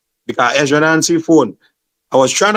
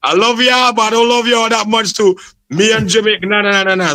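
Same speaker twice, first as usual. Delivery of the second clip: playful refusal; 9 semitones higher than usual. Retold or imitated. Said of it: "I said".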